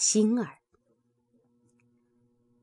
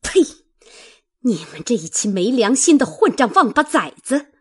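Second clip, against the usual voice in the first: raised-voice tongue-lashing, higher and rapid; furious cursing; 5 semitones higher, 7 dB louder, faster than usual. A narrator's reading. Mistress curses her servants, loud angry scolding. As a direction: spit it out, then a loud, fast tirade. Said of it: "骂道".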